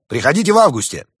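high, loud, quick invitation; chipper. A narrator's reading. Summer spirit puts the doctor off till August, chipper. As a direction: rattle it off brightly and loud. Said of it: "бодро ответил дух лета".

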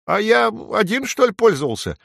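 high little sparrow voice; high-pitched, flustered small bird. A narrator's reading. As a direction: pipe it up, caught off guard. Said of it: "растерялся воробей".

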